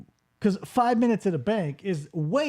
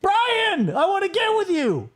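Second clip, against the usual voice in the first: mock cry for help; 12 semitones higher, much slower than usual. Comedian voicing the clerk dragged into the TV, mock panicked cry. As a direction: wail for help, half panicked, as if being grabbed. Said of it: "and she's like".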